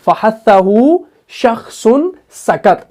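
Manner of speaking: stretched-out Arabic formula, half speed; slow mnemonic recitation. Shown as a demonstration. Teacher reminding students how he taught it, slow recitation. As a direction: draw each word group out. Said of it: "कैसे कैसे मैंने बताया".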